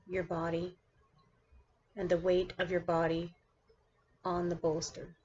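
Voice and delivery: measured meditation-guide delivery with long pauses between phrases; guided meditation. Acted. Teacher guiding her class through seated meditation, guided meditation voice. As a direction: lead the listener into stillness, one phrase at a time.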